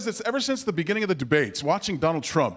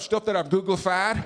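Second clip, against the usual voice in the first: George W. Bush. Retold or imitated. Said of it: "when they ask him about that".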